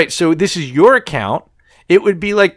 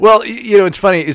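laughing; 8 semitones higher, 4 dB louder than usual. Real, not acted. Laughing after funny story.

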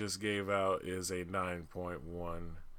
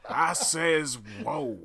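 Yosemite Sam voice; 7 semitones higher, 4 dB louder, much slower than usual. Retold or imitated.

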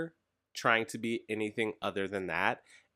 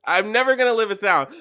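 loud, high outburst; exasperated protest; 10 semitones higher, 10 dB louder than usual. Real, not acted. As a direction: cry out at being teased. Protesting at being teased.

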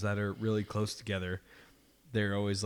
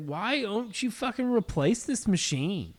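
exasperated outburst; 10 semitones higher, 4 dB louder than usual. Retold or imitated. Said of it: "I was like".

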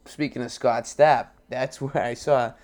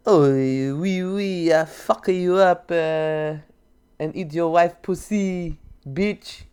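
hammed-up French accent, pitched up; 5 semitones higher, slower than usual. Shown as a demonstration. Co-host asks the actor to show his French accent, hammed-up French. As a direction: put on a drawn-out, higher French accent. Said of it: "Let's hear your French accent".

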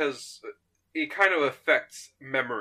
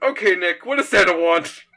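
impatient loud demand; 3 semitones higher, 8 dB louder than usual. Acted. The Doctor cuts through Nick's stalling.

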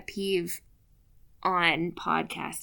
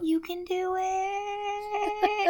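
high, slow, pleading coax; coaxing garlic; 10 semitones higher, slower than usual. Retold or imitated. Cook coaxing the garlic in the pan, high slow pleading. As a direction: beg the garlic tenderly, drawn out. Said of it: "a very good reenactment".

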